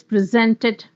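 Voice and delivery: "presented" as a verb, ZENT stressed; pre-ZENT-ed, weight on the middle of "presented"; stress on second syllable. Shown as a demonstration. Teacher shifting stress to make a verb, second-syllable stress. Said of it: "Now just shift the syllable".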